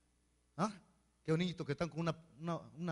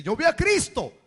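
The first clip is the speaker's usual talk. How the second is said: Thomas exclaiming; 5 semitones higher, 12 dB louder than usual. Acted. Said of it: "podría decir Tomás".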